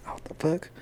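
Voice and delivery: hushed whisper to himself; whispered reaction. Retold or imitated. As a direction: whisper it under your breath, barely any voice. Retold private reaction to phone ringing.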